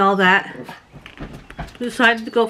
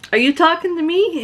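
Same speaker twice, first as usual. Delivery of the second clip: higher, sassy repeat; sassy challenge; 8 semitones higher than usual. Retold or imitated. She challenges the rude lady again, sassy. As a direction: push back at her. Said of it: "I said".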